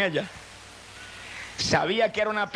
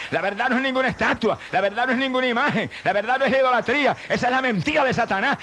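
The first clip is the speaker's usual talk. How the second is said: preaching at full force; 5 semitones higher than usual. Acted.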